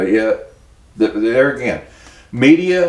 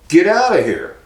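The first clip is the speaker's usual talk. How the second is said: higher, louder mock yell; retold yell; 7 semitones higher, 4 dB louder than usual. Quoting staff at the station who yelled at him, mock yell. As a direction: throw the shout back at the camera. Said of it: "they're yelling".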